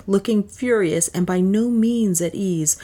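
ordinary delivery, nothing marked

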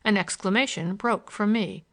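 ordinary delivery, nothing marked

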